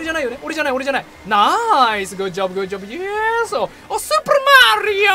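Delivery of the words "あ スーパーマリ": very high cartoon Mario voice; shrill-free squeaky game character; Mario impression. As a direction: pipe it up like Mario. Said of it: "マリオキャラ全部のモノマネやっちゃった".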